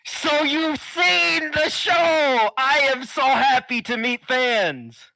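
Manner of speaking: booming, slow, high wrestler voice; lucha libre announcer. Acted. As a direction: shout it like a ring announcer to the crowd. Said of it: "Is he always so loud?".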